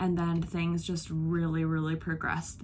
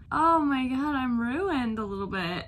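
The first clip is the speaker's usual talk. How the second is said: overwhelmed; 6 semitones higher, 3 dB louder than usual. Real, not acted. Overwhelmed after finishing the book.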